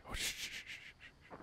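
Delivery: unvoiced hushed shushing; shushing whisper. Real, not acted. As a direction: hush someone urgently. Hiding from monster.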